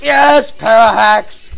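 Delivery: loud, slow drawn-out yes; cheering his opponent's paralysis; triumphant cheer. Retold or imitated. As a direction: savour the paralysis. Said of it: "and I'm like".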